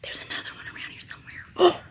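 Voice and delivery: hushed whisper so the bird stays; wildlife watching whisper. Real, not acted. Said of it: "Shh. Don't scare him".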